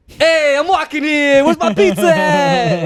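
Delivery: high, loud, drawn-out Italian-American caricature shout. Acted. Pretending to be Aquafina revealing her real voice, over-the-top Italian accent. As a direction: holler it in a hammed-up New York Italian accent. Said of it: "just get really offensively Italian".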